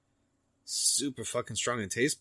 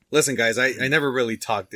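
loud; 4 semitones higher, 10 dB louder than usual. Real, not acted. Loud address to listeners.

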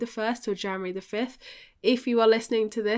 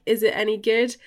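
ordinary talk, nothing marked

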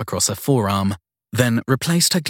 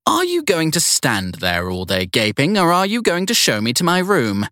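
put-on high, imperious old lady; bossy elderly matron giving orders; prissy strict schoolteacher voice; 8 semitones higher, 3 dB louder than usual. Evelyn snaps at Sadie.

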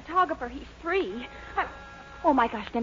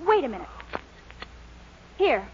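normal talking voice

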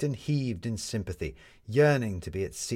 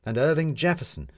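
ordinary delivery, nothing marked

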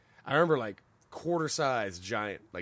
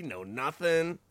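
normal talking voice

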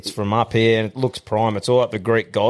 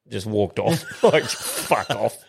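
ordinary talk

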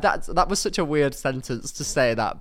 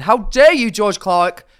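loud, higher hammed-up indignation; mock outrage; 6 semitones higher, 10 dB louder, slower than usual. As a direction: scold him in mock horror.

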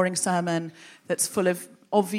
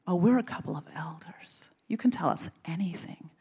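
soft, whispered mock coaxing; imitating a wheedling elder in a whisper; conspiratorial whisper; 2 semitones lower, 6 dB quieter than usual. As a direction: whisper it as if inviting a secret. Elders coaxing her to confide.

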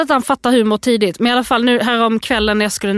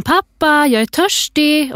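imitated child, high and drawn out; child calling out; 7 semitones higher, slower than usual. Parent quoting storybook boy calling from bed, high calling voice. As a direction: call to dad in a high, stretched little voice. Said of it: "Så ropar han".

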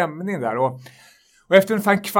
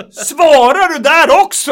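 much higher and louder, slow incredulous shout; outraged disbelief; 10 semitones higher, 12 dB louder, slower than usual. Retold or imitated. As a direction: explode in disbelief at the same clerk. Customer shocked on phone.

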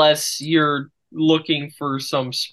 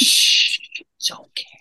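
hushed whisper. Real, not acted. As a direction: whisper to hush them. Hushing after teasing.

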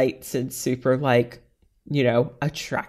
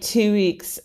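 ordinary talk

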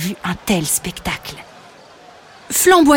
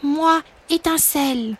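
put-on higher, softer young dragon; little dragon repeating; 6 semitones higher, 5 dB quieter than usual. Little dragon repeats his father's oath, hesitant and higher. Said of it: "il répéta les mots de son père".

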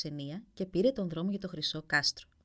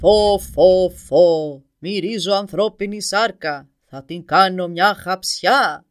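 huge slow roar, much louder; roaring dragon; 15 dB louder, much slower than usual. Acted. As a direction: bellow it like a hungry giant sniffing out a man. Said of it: "φώναξε ο δράκος".

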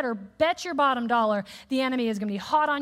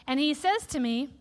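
ordinary talk, nothing marked

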